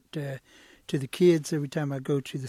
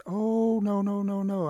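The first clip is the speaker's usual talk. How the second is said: higher, run-together string of no's; alarmed refusal; 5 semitones higher than usual. Acted. Storyteller as Turtle refusing to lend his whistle, alarmed refusal. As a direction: refuse at once, protective of a treasure.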